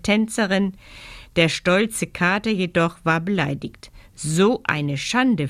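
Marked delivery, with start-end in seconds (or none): none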